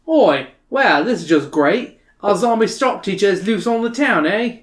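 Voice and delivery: sarcastic Australian bloke; Australian accent. Acted. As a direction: grumble it in a broad Australian accent. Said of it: "great Australian accent like I can".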